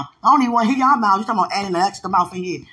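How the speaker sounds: hammed-up fed-up mother, words tumbling out; imitated scolding mother. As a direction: rattle off a retold telling-off. And she was like.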